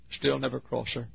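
ordinary delivery

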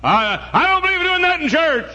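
mock the snob who looks down; preacher mocking snobs in church; snobbish churchgoer. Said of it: "some of you snobs, you say".

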